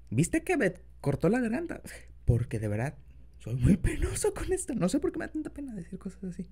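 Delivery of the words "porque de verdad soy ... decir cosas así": put-on soft, low boyfriend voice; tender, shy lover, quiet; incubus. Continues confession to partner.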